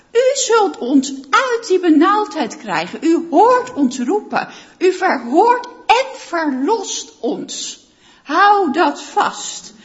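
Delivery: loud, driving sermon delivery; fervent preaching. Acted. Pastor preaching to the congregation, loud and fervent. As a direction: preach it out to the whole church.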